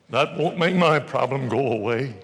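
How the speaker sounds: whiny skeptic. Acted. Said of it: "the thumbsucker will say".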